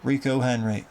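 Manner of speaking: normal talking voice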